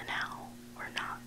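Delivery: plain reading voice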